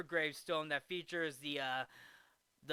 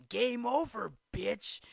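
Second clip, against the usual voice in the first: mock taunt; 6 semitones higher, 6 dB louder, slower than usual. Happy taunting Shooter.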